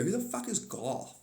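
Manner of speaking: high, puzzled mock voice of world governments; baffled world leaders. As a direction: squeak it out like a confused official.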